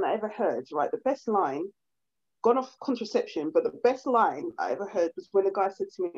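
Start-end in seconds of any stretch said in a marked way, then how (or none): none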